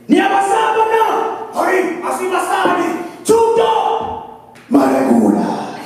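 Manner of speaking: high, loud, stretched war cry; mock battle cry. King rallying his army before the charge, mock war cry. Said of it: "King T'Challa could be just there and just go".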